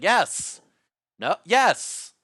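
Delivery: loud, high, empty yes-man replies; robotic simulated people. Retold or imitated. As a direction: answer like a glitchy fake person. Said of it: "they're just like".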